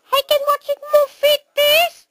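very high, quick mouse chatter. Acted. Mouse asking about watching the movie, quick mouse squeak. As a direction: squeak it fast like a tiny mouse. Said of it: "no more mouse voice".

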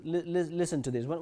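ordinary delivery

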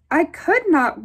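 ordinary delivery, nothing marked

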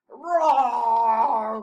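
loud, long pretend monster roar; big storybook growl. A narrator's reading. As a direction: roar like a playful beast. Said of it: "growled Posy".

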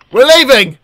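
loud, high announcement; hammed-up exit shout; mock storming out. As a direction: call it out as if quitting on the spot. Acts the character storming out.

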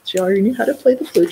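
usual speaking voice

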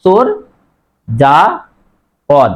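চোর, যা, পদ each said separately; closed syllables. Shown as a demonstration. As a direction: stop after each syllable: "chor, ja, pod". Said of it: "থেমে যাচ্ছে".

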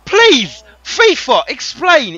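high, loud, drawn-out rage at the game; furious shout. Real, not acted. Streamer yelling at his team's bad pass, furious shout. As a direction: scream your disbelief at the screen.

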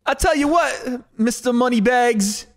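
slow it down and go up, like Walken; mock Walken teasing a rich rival; Christopher Walken impression. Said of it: "That's Walken".

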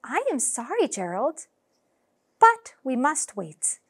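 plain reading voice

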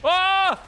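loud, high yell of surprise; short high shriek, loud; startled scream. Real, not acted. Gamer punished for trolling a guy, startled high scream.

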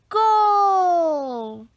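loud, high cheer; shouted goal. A narrator's reading. Boys toss the rabbit into the basket, cheering shout. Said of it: "they shout".

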